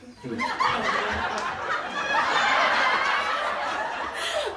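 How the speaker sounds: shrieking high laugh; high laughter. Real, not acted. Cracking up at the haircut.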